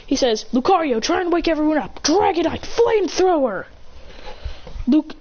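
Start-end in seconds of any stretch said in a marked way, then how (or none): 0.5-3.7 high-pitched called-out move; attack command. Acted. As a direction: call the attack across the field. Trainer shouts attack commands.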